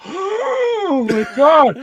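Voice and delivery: high, drawn-out mock gasp of shock; breathy-high, slow "oh my God" of horror. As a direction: stretch it out high, like a horrified onlooker. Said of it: "she's just like".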